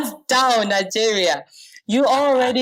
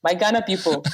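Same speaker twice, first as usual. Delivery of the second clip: ordinary talk, nothing marked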